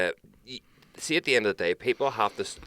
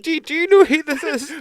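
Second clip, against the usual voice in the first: much higher, loud put-on confused voice; imitating baffled dad; 9 dB louder than usual. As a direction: squeak it like a puzzled dad asking everyone. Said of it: "it's like".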